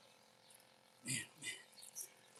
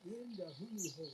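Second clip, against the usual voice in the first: muttered much softer to himself; quiet murmur; 2 semitones higher, 20 dB quieter than usual. Real, not acted. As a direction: say it again under your breath. Repeats phrase to himself.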